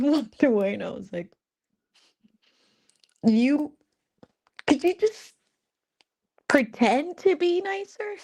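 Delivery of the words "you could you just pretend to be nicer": high, squeaky teasing plea; high-pitched playful pleading; mock plea. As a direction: whine it up high like a joke. Teasing angel to act nicer.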